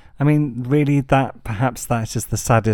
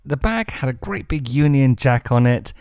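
ordinary delivery

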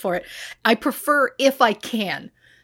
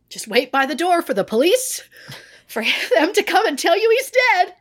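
mock dread; 10 semitones higher than usual. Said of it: "I was like".

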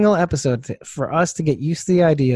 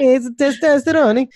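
put-on Italian accent; 10 semitones higher than usual. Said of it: "an Italian accent".

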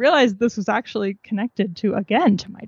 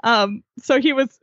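ordinary delivery

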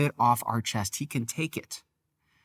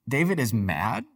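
ordinary delivery

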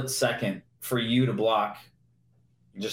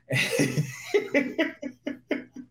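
high breathless imitated giggle; imitated laugh. Teammate laughing at the busted play, high imitated giggle. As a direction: mimic a teammate cracking up. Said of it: "Andre Johnson starts laughing".

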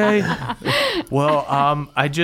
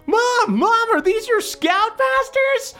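put-on very high, squeaky kid voice; excited dorky youngster; dorky teen kid; slower than usual. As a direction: pester mom in a high, drawn-out whine. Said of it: "stupid, dorky little kids".

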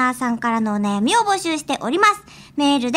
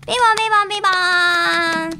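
chime imitation; 5 semitones higher, faster than usual. Jingle chime sound.

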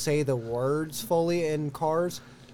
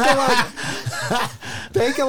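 usual speaking voice

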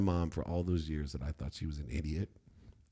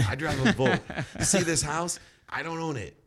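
high, loud, laughing rant to the crowd; preachy lecture; 8 semitones higher, 4 dB louder than usual. Retold or imitated. To party crowd, a loud high preachy rant. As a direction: lay down the law with a laugh. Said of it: "I'm like".

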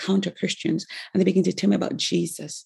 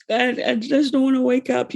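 put-on higher, whiny sleepy-kid voice; sleepy child protesting; 6 semitones higher than usual. Child begging mom to let her sleep, whiny higher voice. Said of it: "And I'm like".

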